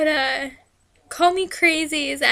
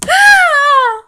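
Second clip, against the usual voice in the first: sudden shrieking scream, much higher and louder; starstruck scream; 12 semitones higher, 15 dB louder than usual. Retold or imitated. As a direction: let out a starstruck fan scream. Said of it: "and just went".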